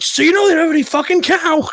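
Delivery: high, fast, mocking yell; sarcastic high taunt while leaving; parting jab. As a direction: shout it over your shoulder on the way out. Parting shot leaving the shop.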